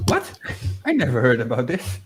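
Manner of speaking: normal talking voice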